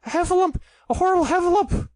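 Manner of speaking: high, loud panicked squeal; frightened cry for help. A narrator's reading. Piglet, fleeing the heffalump trap, shrieks in high panic. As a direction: shriek it like a terrified little pig. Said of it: "still crying out".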